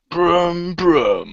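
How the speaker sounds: drawn-out mock car-presenter engine noise; playful, stretched-out engine impression; Jeremy Clarkson. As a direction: impersonate a TV motoring host revving. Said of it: "in a Jeremy Clarkson accent as well".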